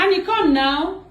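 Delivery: high-pitched urgent summons; calling out. Pretend. Calling the prank victim to come now, calling out. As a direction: call her over right now. So I'll call her.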